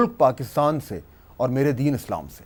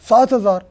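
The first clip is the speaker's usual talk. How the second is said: सात हज़ार repeated higher and louder; incredulous repeat; 4 semitones higher, 5 dB louder than usual. Real, not acted. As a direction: punch out सात हज़ार in disbelief. Repeats the wage figure in disbelief.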